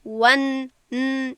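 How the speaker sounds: ون with the n isolated and sounded out; clear nun. Shown as a demonstration. Teacher isolates the letter for students, clear n in ون. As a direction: break off on ون, then sound the n alone.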